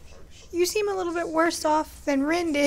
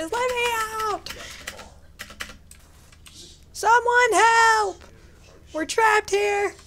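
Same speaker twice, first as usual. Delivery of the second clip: panicked calling for help; 6 semitones higher, slower than usual. Real, not acted. Trapped player calling out for help, panicked. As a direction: shout for anyone to come.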